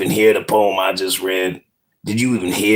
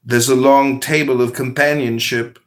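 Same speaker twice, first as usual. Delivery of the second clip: calm, measured parable reading; unhurried storyteller voice; story narration; 5 semitones higher than usual. Acted. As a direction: tell it like a wise fable. Reads a spiritual parable aloud.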